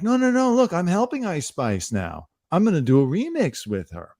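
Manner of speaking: high, hurried pleading no no no; mock damage control. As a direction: pretend pop star backpedalling. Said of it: "do damage control and be like".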